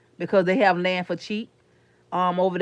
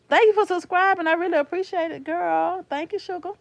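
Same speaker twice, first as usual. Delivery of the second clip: bubbly thanks; 9 semitones higher than usual. Real, not acted. Thanking a new subscriber.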